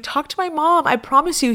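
retold pleading, pitched high; insistent plea. Pleading with her cousin to let her go, insistent.

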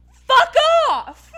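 high, very loud outburst; furious shout. Real, not acted. Fan's last word to a nosy man, furious yell. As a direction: explode on it, far above normal pitch. Last point of angry rebuke.

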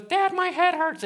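put-on high, whiny kid voice; a boy complaining to his dad; hurting child. Son telling his father his head hurts, high and whiny.

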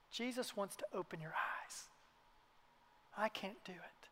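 hushed whisper of invitation. Real, not acted. Preacher whispering to listeners, hushed and intimate. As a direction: whisper it like a secret hope.

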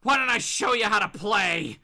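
angry dare. Acted. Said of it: "Bob goes".

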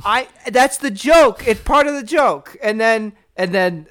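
high, loud, insistent objection; exasperated protest. Real, not acted. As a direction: protest loudly over being interrupted. Protests his joke being spoiled.

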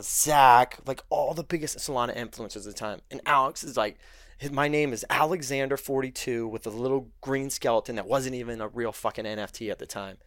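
usual speaking voice

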